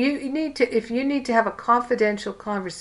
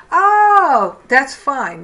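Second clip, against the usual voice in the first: Oh jumps high and loud, then that's fine; a big high surprised Oh of relief; retold party guest's loud surprised reaction; 5 semitones higher, 9 dB louder, much slower than usual.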